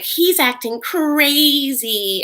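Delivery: high, drawn-out mocking wail on "crazy"; mock accusation. Lizzie calling him out, retold as mock accusation. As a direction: stretch it out slowly in mock outrage.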